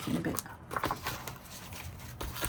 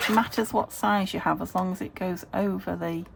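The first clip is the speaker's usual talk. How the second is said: ordinary talk, nothing marked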